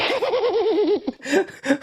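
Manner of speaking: high, long burst of laughter; real laugh. Real, not acted. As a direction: crack up at the chat. Laughing at chat insult.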